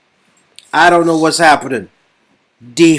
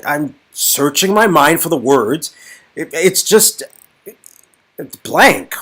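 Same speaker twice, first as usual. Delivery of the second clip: ordinary talk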